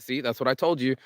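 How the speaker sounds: usual speaking voice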